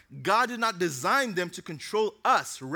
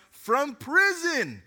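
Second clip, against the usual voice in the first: excited emphasis; 6 semitones higher, 4 dB louder, slower than usual. Real, not acted. Preacher emphasizes Paul jailed.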